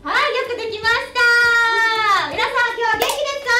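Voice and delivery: high-pitched mock-teacher praise; pretend teacher. Teacher praising pupils and checking in, peppy pretend-teacher voice. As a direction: play an over-keen teacher working the class. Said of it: "そんな先生は嫌だをやりました".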